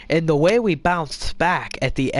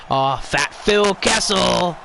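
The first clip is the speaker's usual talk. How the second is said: excited play-by-play; 6 semitones higher, slower than usual. Real, not acted. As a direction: call the goal like a hyped commentator. Calling his own goal in-game.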